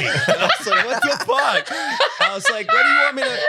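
high-pitched laughter breaking through; laughing while telling it; squealing with laughter. Real, not acted. Retelling dream, laughing.

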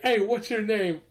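put-on half-playful stranger hailing a child; imitating Fishburne calling out. Chess hustler calls to a departing kid, lightly voiced.

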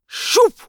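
sharp whooshing spear sound; shoop. A narrator's reading. Narrator voicing the spear launch with a whoosh. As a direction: spit out a quick whoosh.

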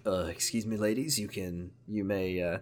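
a soft, low, courteous brute; a lightly voiced, too-gentle large man; wrong voice for him. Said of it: "this is not the voice that we're gonna do for this man".

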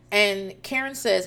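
normal talking voice

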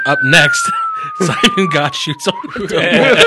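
very high hammed-up announcer; mock announcer. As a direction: hype it like a TV teaser.

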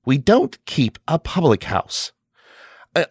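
a touch of coldness, flatly refusing; cold reply. Acted. Mother refuses the stranger lodging, mildly cold. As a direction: turn the stranger away with mild chill. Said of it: "replied coldly".

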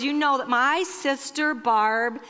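normal talking voice

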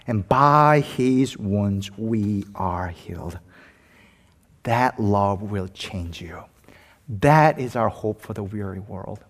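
slow, weighty sermon close; solemn preaching. Real, not acted. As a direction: bring it down, measured and deliberate.

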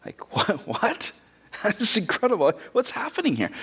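high, breathy disbelief with a laugh; incredulous. Real, not acted. Preacher to congregation, amazed at the gardener mix-up.

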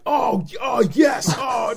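high, gushing fanboy cries; mock ecstatic excitement. Teasing Sean about New Gods film news, mock ecstatic. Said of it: "you were like".

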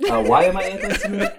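fast and high, through laughter; laughing. Real, not acted. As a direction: laugh out the question in disbelief. Repeating question, laughing.

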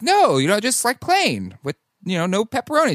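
high-pitched retold explanation; mock explaining. Retelling in-laws explaining plain pie, high mock explaining. Acting out New York pizza order exchange.

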